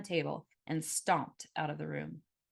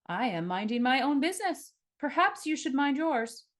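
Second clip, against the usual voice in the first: loud enough to hear; 8 semitones higher, 4 dB louder than usual. A narrator's reading. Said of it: "He spoke loud enough that the witnesses would hear".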